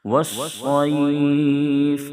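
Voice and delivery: والصیف with the final vowel held long and sung; drawn-out Quranic vowel; long stretched vowel. Shown as a demonstration. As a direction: draw out والصیف for about two seconds. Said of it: "دو یا ڈھائی second کھینچیں گے".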